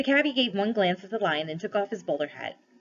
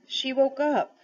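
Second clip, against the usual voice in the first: put-on higher, miserable boy; wretched; 7 semitones higher, slower than usual. A narrator's reading. Diggory admitting fault to Aslan, wretched and higher. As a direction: confess it miserably. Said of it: "said Diggory wretchedly".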